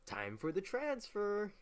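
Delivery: high, slow, grandly announced; mock Britain. Britain announcing troops go to America, high slow mock announcement. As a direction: ham it up as pompous Britain. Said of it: "so Britain's like".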